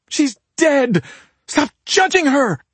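very high, loud, anguished shrieking; screamed outburst at the top of the range; distraught shriek. A narrator's reading. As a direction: shriek it in grief and fury. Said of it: "Claudia shrieked".

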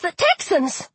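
high-pitched yelp of alarm; alarmed, high exclamation. A narrator's reading. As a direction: blurt it out high in sudden dread. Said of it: "I yelped".

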